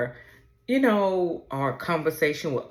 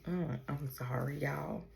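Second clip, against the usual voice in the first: very soft, real apology under the breath; subdued tearful apology; 12 dB quieter than usual. Real, not acted. Grieving mother apologizes to her viewers, subdued and tearful. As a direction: drop right down, holding back tears. Apologizing while overcome by grief.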